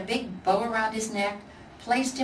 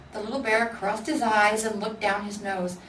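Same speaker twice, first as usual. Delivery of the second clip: plain reading voice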